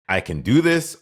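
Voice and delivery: high, loud pep-talk to yourself; imitating a confident inner voice; confident self-talk. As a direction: pump yourself up, sure you can do it. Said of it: "Were you like".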